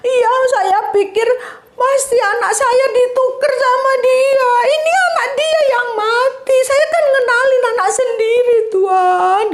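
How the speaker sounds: high, sobbing accusation; crying mother. Acted. The weeping mother tells the king her baby was swapped, sobbing high.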